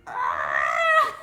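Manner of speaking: loud crying wail; drugged wail. Real, not acted. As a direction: let out a wailing cry. Patient cries out, drugged.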